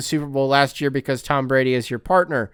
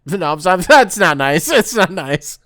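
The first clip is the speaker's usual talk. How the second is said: normal talking voice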